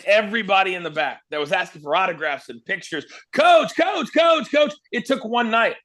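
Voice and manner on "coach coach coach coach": high, loud chant of the name; imitating fans shouting for the coach; fans calling out. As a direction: call it out like an eager crowd.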